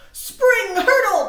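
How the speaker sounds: high, loud, drawn-out hurdle; leaping call. A narrator's reading. Reading the bunnies leaping, high and loud. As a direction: launch it like a jump.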